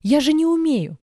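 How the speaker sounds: high, loud, flustered cry; panicked protest. A narrator's reading. Boy refusing the task, panicked. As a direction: blurt it out in alarm.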